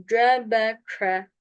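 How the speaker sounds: "C B K" slow, with Arabic sounds; correct Arabic articulation. Shown as a demonstration. Teacher models for students, "C B K" in careful Arabic. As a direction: articulate "C B K" slowly, Arabic-style. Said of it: "Yanlış oku hocam".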